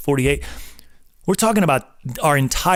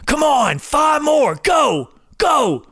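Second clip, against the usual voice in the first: trainer shouting; 11 semitones higher, much slower than usual. Retold or imitated. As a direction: scream encouragement into their ear. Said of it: "screaming in their ear".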